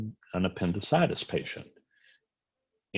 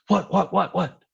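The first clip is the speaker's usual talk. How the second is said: high, loud, rapid startled whats; startled patient; 12 semitones higher, 5 dB louder than usual. Retold or imitated. Sleeping patient startled awake by the exam, high and loud. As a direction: jolt awake mid-exam.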